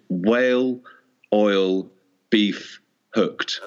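very slow, each word set apart. Shown as a demonstration. Co-host reading out a punning team name, slow and separated. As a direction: spell out the pun slowly, one word at a time.

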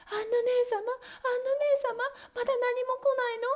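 frightened wife calling up the tower. Acted. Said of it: "叫び続けていました".

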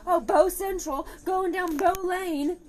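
mocking imitation. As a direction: mimic your partner, stretching it out high. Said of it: "You're like".

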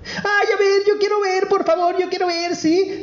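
very high, eager little princess. Acted. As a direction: squeal up high like a small girl begging. Princess pleading.

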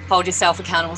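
normal talking voice

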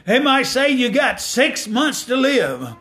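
climbing gospel preacher cadence; preaching at full force. Acted. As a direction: lift the congregation up.

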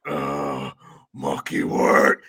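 very low, slow grunting heavy; Bane. Acted. Host mocks the movie's grunting mercenary, deep grunting voice. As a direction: grunt the words out slowly, deep down. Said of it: "is a grunting man".

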